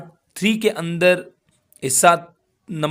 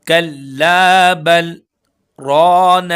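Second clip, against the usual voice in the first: कल्ला बल held with a pause, very slow and louder; pause without breath; 6 dB louder, much slower than usual. Shown as a demonstration. Teacher shows the sakta pause to students, slow chant. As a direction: stop after बल but keep the breath. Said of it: "यहाँ पर सांस नहीं तोड़ना".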